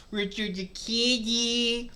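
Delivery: cooing. Real, not acted. Streamer fusses over the cute cat, cooing. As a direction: coo fondly at a kitty.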